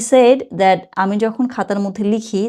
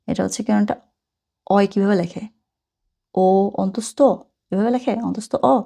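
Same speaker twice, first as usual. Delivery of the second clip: usual speaking voice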